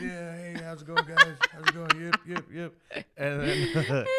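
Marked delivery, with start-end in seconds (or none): 0.0-3.1 chuckling through a breezy hello; laughing while greeting the kids. Retold or imitated. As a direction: wave them off with a laugh. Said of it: "And I'm like this".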